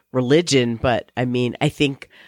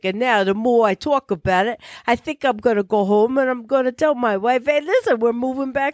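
put-on higher Bronx-style New York accent; 9 semitones higher than usual. Friend imitates the New York driver deciding to move back, Bronx accent. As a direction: play the burly Bronx driver. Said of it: "You're doing it so good".

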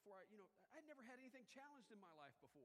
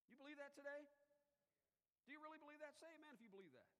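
loud, higher preacher's call; fervent preaching; 6 semitones higher, 6 dB louder than usual. Acted. Pastor calling the church to say amen, fervent. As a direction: rouse the congregation to answer.

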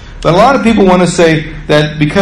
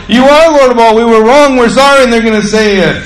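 pleading confession; 8 semitones higher than usual. Retold or imitated. Unbelievers begging before Jesus.

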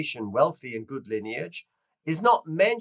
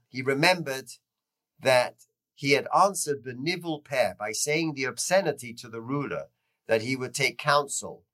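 usual speaking voice

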